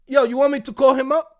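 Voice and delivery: loud, in-your-face challenge; challenging. Retold or imitated. To the receptionist, loud challenge. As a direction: dare her to doubt him.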